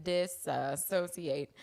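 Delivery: "Disassociate" stretched out very slowly; drawn-out. Shown as a demonstration.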